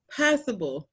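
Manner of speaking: ordinary talk, nothing marked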